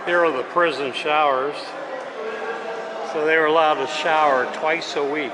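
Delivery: talking loud in a busy echoing hall; speaking up over crowd noise. Real, not acted. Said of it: "it's quite loud in here".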